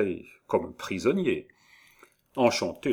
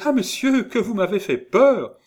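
very high, loud flustered voice; startled young woman; 13 semitones higher, 7 dB louder than usual. A narrator's reading. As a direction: scold him while getting over a fright. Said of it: "Gervaise en poussant un joli petit cri". (jeune femme effrayée, voix aiguë)

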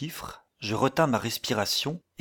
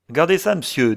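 raised voice, calling someone over; 6 semitones higher, 7 dB louder than usual. A narrator's reading. Sailor showing his employer the cabin, calling out. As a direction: call attention to a find.

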